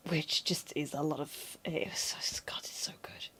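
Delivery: breathless whispered gush; whispering. Real, not acted. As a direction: whisper it like a secret treasure. Gushing aside about favourite series.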